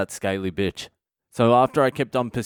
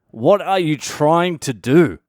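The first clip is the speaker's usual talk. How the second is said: high, slow, emphatic question; exasperated, higher-pitched probing; insistent questioning; 4 semitones higher, 5 dB louder, slower than usual. Retold or imitated. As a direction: press for an answer, drawing out each stressed word. Retold demand to the schizophrenic friend.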